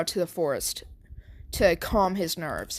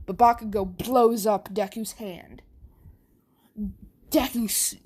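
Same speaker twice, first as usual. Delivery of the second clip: ordinary delivery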